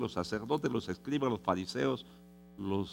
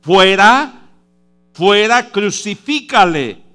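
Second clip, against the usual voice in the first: high, loud mob yell, slowing on crucifícale; furious crowd cry from the pulpit; crowd shouting; 10 semitones higher, 18 dB louder, slower than usual. Acted. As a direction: shout it like an angry mob demanding death. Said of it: "ellos gritaron".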